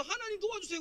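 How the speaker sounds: high-pitched cry for help, lightly acted; moaning plea. Trampled victim begging God for help, moaning plea. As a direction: imitate a victim pleading through groans. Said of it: "막 신음하면서".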